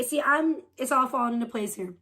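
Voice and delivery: normal talking voice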